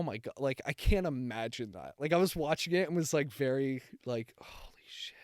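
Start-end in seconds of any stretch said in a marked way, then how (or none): 4.4-5.2 a soft unvoiced whisper of disbelief; whispered shock. Recalling watching the episode, a whispered holy shit. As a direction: breathe it out, floored. Reacting while watching the show.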